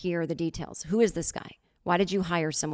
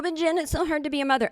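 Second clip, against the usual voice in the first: a higher, fast mocking whine of an imagined critic; mock whiny complaint; 8 semitones higher, faster than usual. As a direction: whine it fast and high to make fun of the excuse. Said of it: "And you go".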